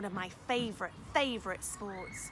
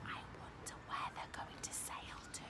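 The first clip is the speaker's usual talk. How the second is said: whisper; 10 dB quieter than usual. Real, not acted. Whispered aside to children.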